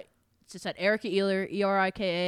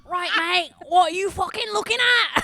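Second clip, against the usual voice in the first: loud, high Mancunian lad yelling; Manchester accent; 9 semitones higher, 7 dB louder than usual. Pretend. Asked for her best accent, a loud Manchester accent. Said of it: "That's very Manchester".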